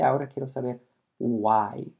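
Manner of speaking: ordinary talk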